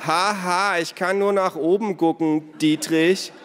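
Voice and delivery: loud, high mocking complaint; sarcastic retort. A narrator's reading. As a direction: snap back with a fake laugh. Sarcastic retort in shouted squabble.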